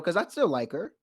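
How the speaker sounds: usual speaking voice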